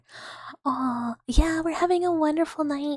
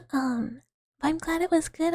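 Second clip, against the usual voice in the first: normal talking voice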